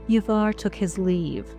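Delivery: ordinary talk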